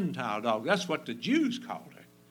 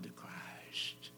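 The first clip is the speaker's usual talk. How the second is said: slow, soft unvoiced plea; tender whispered call to come; whispered invitation; 15 dB quieter than usual. Real, not acted. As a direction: whisper it, slowly, pleading.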